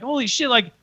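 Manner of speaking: high, incredulous fan imitation; amazed recognition. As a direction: act out a starstruck double-take. Retold fan recognizes the hosts.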